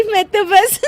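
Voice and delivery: bursting into a high giggle; can't help laughing. Acted. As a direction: bahu bursting into laughter mid-excuse. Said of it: "तू फिर से हंस दी".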